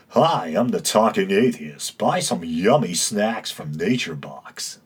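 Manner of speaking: mock polished broadcaster with swooping pitch; unnaturally smooth radio voice. Podcaster teasing a fellow atheist host's polished delivery, smooth radio voice. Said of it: "that unnaturally smooth, uh, talking radio voice".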